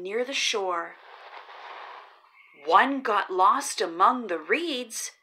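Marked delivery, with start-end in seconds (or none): none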